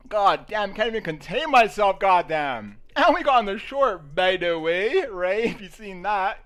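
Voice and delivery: loud, high bragging; excited celebration. Real, not acted. Bragging to viewers about the trade, loud and excited. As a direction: hype yourself up.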